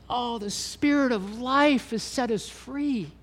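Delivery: high, slow, full-hearted wonder; emotional realisation. Real, not acted. Preacher reliving the moment he realised he was freed, in awe. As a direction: let it rise in awe, slowly.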